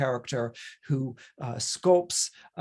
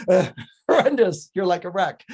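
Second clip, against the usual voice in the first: louder scolding "horrendous"; scornful outburst; 3 semitones higher, 6 dB louder than usual. Retold or imitated. Professor scolding the flower girl's vowels, loud scorn. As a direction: slam her attempt with disgust.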